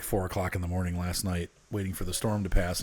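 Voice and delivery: ordinary talk, nothing marked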